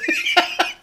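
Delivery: high-pitched burst of laughter; helpless laugh. Real, not acted. Reviewer tickled by their own accent slip, helpless laugh. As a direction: crack up, high and breathless.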